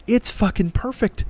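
ordinary talk

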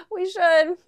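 high, loud, giggly reply; laughing agreement. Retold or imitated. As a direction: imitate agreeing through a laugh. Laughing along with friends' suggestion.

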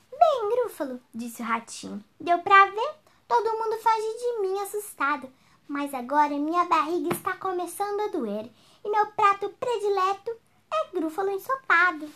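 high, sly little mouse; cheeky mouse. Acted. Mouse slyly threatens the gruffalo. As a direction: tease the big monster.

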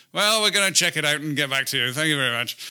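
a hint of a polite, unbothered Brit; lightly mock the calm British reply; reserved Brit. Mocking how Brits react to aliens, lightly polite voice. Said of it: "us Brits are just going".

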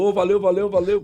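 mock greeting to fans while passing; acted passing thanks.

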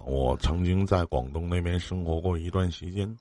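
normal talking voice

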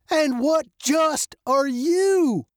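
put-on high, slow old man question; pointed challenge. A narrator's reading. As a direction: draw the question out at her.

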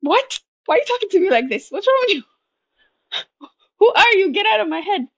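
high-pitched mock outrage at the voice in her head; shocked outburst. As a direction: recoil at your own inner critic, half laughing. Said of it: "And I'm like".

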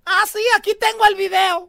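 high, loud taunting mom voice; hammed-up mother gloating at her grown daughter; mock mom. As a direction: shout the proof at the kid in triumph.

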